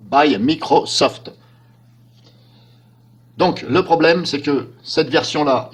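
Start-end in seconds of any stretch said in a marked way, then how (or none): 0.1-1.3 put-on English accent. English tag after Windows.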